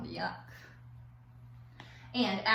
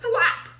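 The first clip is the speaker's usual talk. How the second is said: sound effect; 12 semitones higher, 9 dB louder than usual. Acted. Sound effect of tongue shooting.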